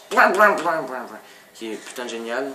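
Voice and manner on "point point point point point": bleep imitation. Censoring a swear.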